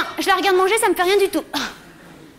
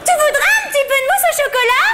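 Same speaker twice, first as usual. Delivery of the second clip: sugary mother voice; 8 semitones higher than usual. Retold or imitated. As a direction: play the mother pushing dessert, way up high. Voicing the mother offering dessert.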